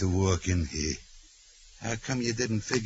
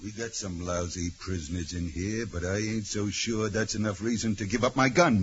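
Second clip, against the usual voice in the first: ordinary talk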